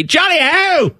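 high-pitched put-on cry; mock English caricature. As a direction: send up an Englishman's exclamation. Mocking imitation of English people.

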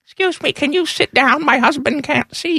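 high, put-on voice of a woman fan; complaining fan. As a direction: imitate a fussy spectator asking someone to sit. Mocking a complaining fellow fan.

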